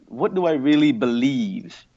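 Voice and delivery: normal talking voice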